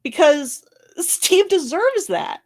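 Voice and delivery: choking up while defending Steve; crying. Real, not acted. Tearful vlogger defending Steve to her viewers, voice breaking. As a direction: voice cracking high and slow through tears. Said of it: "I'm the one that's sitting here on camera crying".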